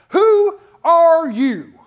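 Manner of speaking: slow, loud and high, defiant; with attitude. Shown as a demonstration. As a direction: stretch it out as a challenge. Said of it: "See, I need some attitude behind it".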